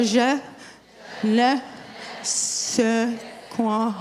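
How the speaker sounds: "Je", "ne", "sais quoi" with long pauses between; slow syllable-by-syllable demo. Shown as a demonstration. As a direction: break "Je ne sais quoi" apart slowly for learners. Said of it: "So here we go".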